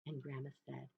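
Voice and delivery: usual speaking voice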